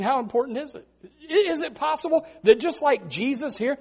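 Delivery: ordinary delivery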